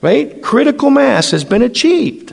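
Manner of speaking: high, rushed listing of wedding plans; mock-panicked groom arguing with Jesus; frantic objection. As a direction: pile up excuses in a panic. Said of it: "And I'm going".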